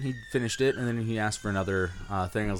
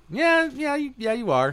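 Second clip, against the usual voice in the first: squeaky sarcastic yeah yeah; high mocking voice. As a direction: pitch it way up and taunt. Retold bartender arguing with drunk.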